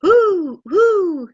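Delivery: high loud owl hooting. Acted. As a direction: hoot it out like a big round owl. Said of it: "said a big round owl".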